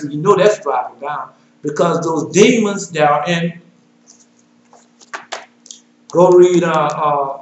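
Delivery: normal talking voice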